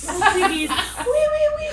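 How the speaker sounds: hammed-up high French oui oui; high, laughing mock French. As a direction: squeal it like a cartoon Frenchwoman. Mock French flirting with client.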